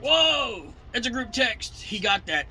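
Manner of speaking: high, loud startled warning, then settling; alarmed whoa. Retold or imitated. Dispatcher's alarmed reply quoted.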